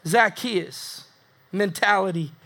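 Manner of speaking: usual speaking voice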